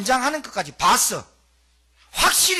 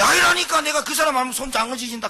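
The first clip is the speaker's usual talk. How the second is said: loud, higher protest that builds on 아니라니까; insistent denial; 5 semitones higher, 5 dB louder than usual. Retold or imitated. Peter swearing to the crowd he never knew Jesus, loud. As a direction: swear it off hotly. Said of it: "하면서 막 이랬거든".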